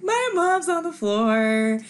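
high, slow, stunned telling of the spill; retold panic. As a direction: drag it out high, in shock. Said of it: "and I'm just like".

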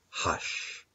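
breathy unvoiced hush; whispered hush. A narrator's reading. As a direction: whisper it to sleeping babies. Hushing the sleeping babies.

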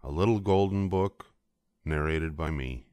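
plain reading voice

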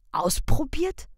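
breathless whispered disbelief; hushed shocked question. A narrator's reading. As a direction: whisper it in dawning horror.

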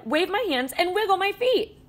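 usual speaking voice

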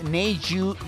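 ordinary talk

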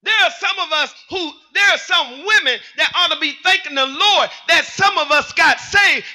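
loud, rhythmic preaching shouts; full-force preaching. Real, not acted. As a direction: fire up the congregation with each line.

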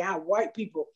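ordinary talk

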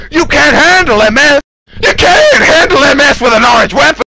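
escalating gamer shout; mock ranting shout. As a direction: rant it like an angry gamer. Mock forum rant escalating.

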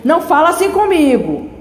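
raging outburst. Acted. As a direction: snap at her in rising anger. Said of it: "Ele então esbraveja".

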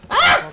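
high, loud shriek; startled scream. Real, not acted. Player caught by zombies, startled shriek. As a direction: shriek in sudden fright.

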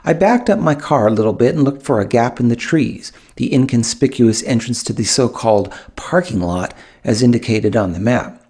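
ordinary delivery, nothing marked

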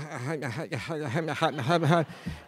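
awkward embarrassed chuckle; sheepish laugh. Retold or imitated. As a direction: laugh it off, caught without an answer. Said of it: "intelligently, I said".

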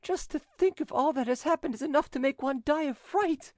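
high woman's voice, fearful; frightened widow. A narrator's reading. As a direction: fret in fright.

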